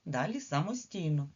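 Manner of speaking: usual speaking voice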